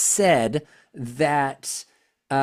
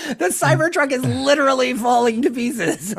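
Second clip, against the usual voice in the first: laughing; 10 semitones higher than usual. Real, not acted. Mocking Tesla, laughing.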